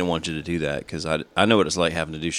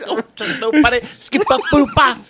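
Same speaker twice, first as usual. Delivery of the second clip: laughing mock scat; 5 dB louder than usual. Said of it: "mocking friend's scat".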